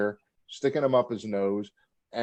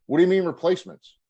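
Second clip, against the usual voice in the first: retold indignation, high, loud and quick; indignant challenge; 7 semitones higher, 6 dB louder, faster than usual. New Jack confronting his boss over replacements, indignant. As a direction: throw the question back, affronted.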